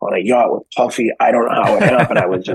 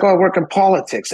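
much higher, mock-modest Blair voice; understated reply; 11 semitones higher than usual. Blair downplaying his job to the guest, mock-modest. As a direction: toss it off like it's nothing. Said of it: "He's like".

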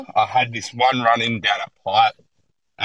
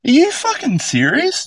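shrill, much higher, louder, incredulous; outraged disbelief; 3 dB louder than usual. Retold or imitated. Burnt surfer snapping back at the local, shocked high outrage. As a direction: replay your own disbelief at being burnt. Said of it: "I was like".

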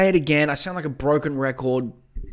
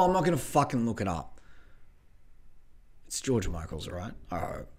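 ordinary talk

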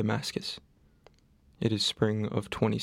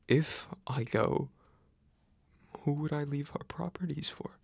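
hushed worry; 3 semitones higher, 5 dB quieter than usual. Acted. Nurse worrying about leaving his properties, in hushed doubt.

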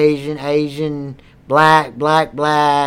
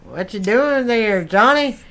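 ordinary talk

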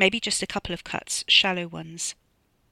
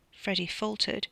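plain reading voice

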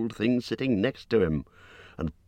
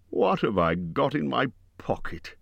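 higher-pitched musing question; saying it aloud; 6 semitones higher than usual. A narrator's reading. Bilbo talking to himself about his pocket, musing aloud. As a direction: wonder aloud to yourself. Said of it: "he said aloud".

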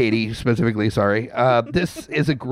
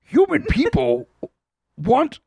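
much higher, slow broken-grammar creature voice; Yoda-like shark voice; slower than usual. Acted. Podcast host answering as a shark, in a high slow Yoda-like voice. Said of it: "That had some Yoda going on".

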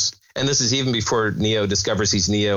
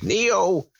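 lightly voiced old woman; a hint of a creaky old lady calling; ridiculous old person voice; 8 semitones higher than usual. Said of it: "in her totally ridiculous old person voice".

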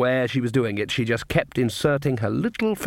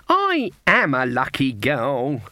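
put-on smug old crone; slower than usual. Old witch gloats at cards.

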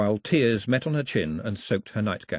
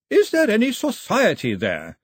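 "society" said distinctly, as if capitalised; capital S in society; 11 semitones higher, 5 dB louder than usual. Acted. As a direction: lean on "society" so the capital is heard. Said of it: "could say a capital letter so distinctly".

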